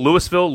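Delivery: Louisville said LOO-iss-vill; quiz pronunciation option. Shown as a demonstration. Host repeats the choices, Louisville as LOO-iss-vill. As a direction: sound the S in Louisville. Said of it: "How do you pronounce".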